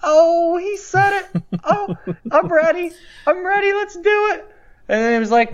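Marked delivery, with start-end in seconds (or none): 0.0-4.4 very high, excited fan squeal; overjoyed fan. Retold or imitated. Hearing Hunter's words, cohost listening, very high gleeful squeal. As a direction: squeal with teenage glee. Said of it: "And I was like".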